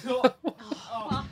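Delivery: nauseous, laughing weakly; about to throw up. Real, not acted. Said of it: "I gotta not think about it".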